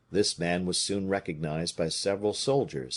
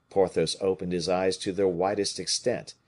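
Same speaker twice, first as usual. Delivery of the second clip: ordinary delivery, nothing marked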